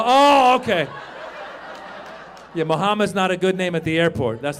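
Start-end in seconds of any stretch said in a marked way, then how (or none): 0.0-0.9 higher, louder surprised oh; sudden backing down. Retold or imitated. Husband gives in to his wife, surprised and loud. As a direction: cave in at once. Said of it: "I go".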